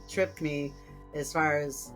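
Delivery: ordinary talk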